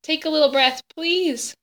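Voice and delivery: high, pleading, on the edge of tears; desperate plea. A narrator's reading. Bryce begs dying sister.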